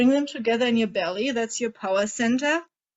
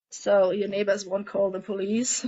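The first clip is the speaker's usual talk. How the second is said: normal talking voice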